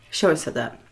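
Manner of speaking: ordinary delivery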